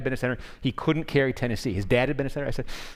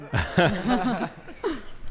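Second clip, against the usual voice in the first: laugh; 10 semitones higher than usual. Real, not acted. Laughing at the question.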